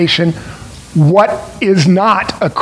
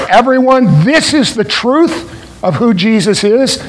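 higher-pitched proclamation to everyone; calling out; 4 semitones higher than usual. Acted. Preacher voicing the church's call to the world, proclaiming. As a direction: proclaim it out to a crowd, pitch lifted.

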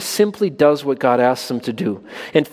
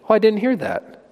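higher, put-on innocent kid voice; feigned innocent kid; 7 semitones higher than usual. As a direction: play the kid who pretends he never heard. Kid pretending not to hear.

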